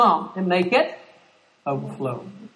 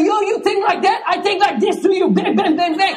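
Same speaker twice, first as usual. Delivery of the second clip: mock retort; 9 semitones higher, 4 dB louder than usual. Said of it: "And I say".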